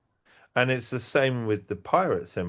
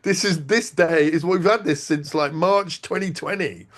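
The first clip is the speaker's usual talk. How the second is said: a worked-up no, pitched high; exasperated protest; 7 semitones higher than usual. Retold or imitated. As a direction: push back hard and fast. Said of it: "I was like".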